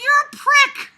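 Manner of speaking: high, loud spat insult; mock insult. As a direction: snap at an imagined rude boyfriend. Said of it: "I'd be like".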